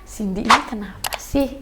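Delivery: low, very soft acted aside; hushed, downcast wondering; affected by bad mood. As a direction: mutter it under your breath, uneasily. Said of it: "lagi bad mood gitu".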